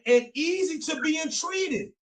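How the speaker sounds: rising to a fervent preacher's cry; preaching climax. Real, not acted. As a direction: let the verse break into a fervent cry.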